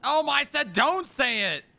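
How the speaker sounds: loud, high, exasperated; exasperated outburst. Real, not acted. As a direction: blurt it out in alarm. Dog panics at the word vet.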